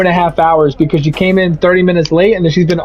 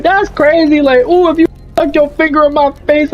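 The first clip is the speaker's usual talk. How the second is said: high-pitched, offended mock of the coworker; indignant coworker; 8 semitones higher than usual. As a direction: play her huffy and wounded, pitched way up.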